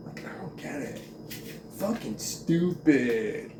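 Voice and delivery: whispered muttering; under breath. Real, not acted. Vlogger mutters to herself after the rant, whispered. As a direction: mutter it to yourself, barely audible.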